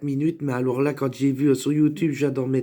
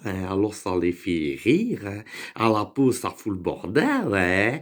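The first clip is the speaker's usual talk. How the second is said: broad regional French accent; 4 semitones lower than usual. Shown as a demonstration. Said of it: "surtout quand je te parle comme ça".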